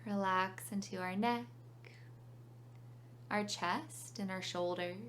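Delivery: calm meditation guide with long pauses; unhurried phrases, leaving room to breathe; guided meditation. Real, not acted.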